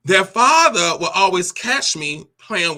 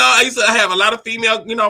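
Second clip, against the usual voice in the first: ordinary talk, nothing marked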